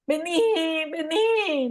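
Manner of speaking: acted high-pitched beckoning; emotional summons. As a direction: call someone over, stretching each final vowel up high. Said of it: "dimensión emocional contenida en la respuesta".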